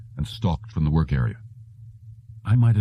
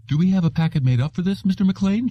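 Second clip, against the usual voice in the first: much higher, eager questioning voice; agitated receptionist; 13 semitones higher than usual. A narrator's reading. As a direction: ask it breathless and eager. Shirley asking, agitated.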